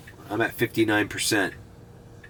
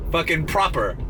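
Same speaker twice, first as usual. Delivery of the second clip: mock British "proper"; 7 semitones higher than usual. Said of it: "as they like to say in Britain".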